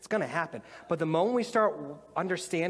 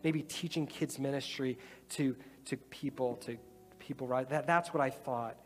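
soft, lowered, reflective prayer talk; quiet prayer voice; 3 semitones lower, 4 dB quieter than usual. Acted. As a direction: draw the room into quiet reflection. Leads prayer with eyes closed.

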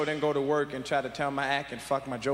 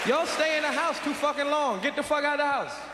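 higher, shouting at the screen; exasperated yell; 9 semitones higher, 3 dB louder than usual. Acted. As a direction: yell at the characters to leave. Yelling at movie characters.

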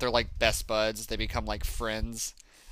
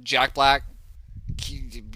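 normal talking voice

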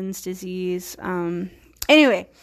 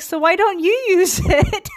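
high-pitched imagined comeback breaking into laughter; laughing retort; 11 semitones higher, 4 dB louder than usual. Retold or imitated. Caregiver's unspoken retort to his angry client, high and laughing. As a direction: toss it out high, cracking up at your own jibe.

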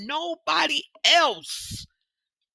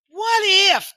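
asking herself; 10 semitones higher, 4 dB louder than usual. Retold or imitated. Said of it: "Then I ask myself".

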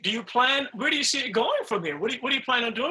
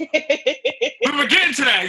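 laughing; 4 semitones higher, 3 dB louder, slower than usual. Real, not acted. Teasing host, laughing.

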